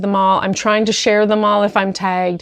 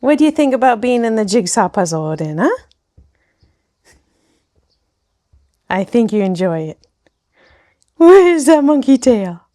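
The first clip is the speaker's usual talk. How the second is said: pet talk; 3 semitones higher than usual. Real, not acted. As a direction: coax the dog sweetly, drawing it out. Talking to her dog Odin.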